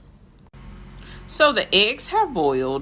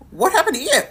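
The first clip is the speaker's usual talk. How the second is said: shocked question; 9 semitones higher, 3 dB louder, much faster than usual. Retold or imitated. Shocked at his taped face.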